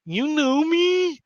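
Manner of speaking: very high, slow creature voice; drawn-out, loud little squeak; Lulu character voice. Acted. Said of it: "Lulu's like".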